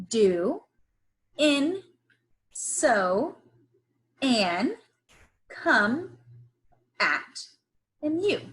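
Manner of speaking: each sight word set apart and drawn out; slow word-by-word reading. Shown as a demonstration. Teacher reading the sight words slowly to the class. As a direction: read the list slowly for young learners.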